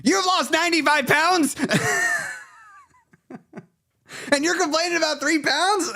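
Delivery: very high, stunned disbelief; can't believe what she's reading; incredulous. Real, not acted. Reading a viewer's comment, high incredulous disbelief.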